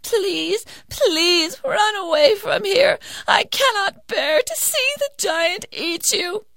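high, tearful plea; high weeping old woman; begging mother. Acted. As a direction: cry as you beg. Mother begs in tears.

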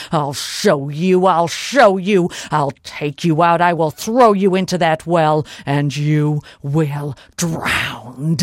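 loud, weighty giant's threat, slowing at the end; menacing giant. Acted. Giant threatens to drown captured Molly, loud menace. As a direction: threaten as an angry giant, drawing out the last words.

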